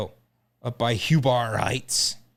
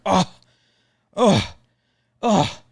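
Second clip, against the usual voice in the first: loud, high hammed-up groans of disgust; 8 semitones higher, 6 dB louder, much slower than usual. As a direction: groan like you just tasted something rotten. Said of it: "like I'm disgusted".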